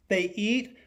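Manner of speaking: ordinary talk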